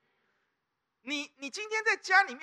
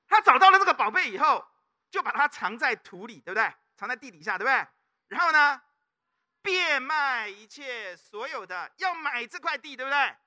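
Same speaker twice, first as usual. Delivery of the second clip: fervent preaching; 7 dB louder than usual. Acted. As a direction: preach it with fire, stretching key words. Preaching at rising force.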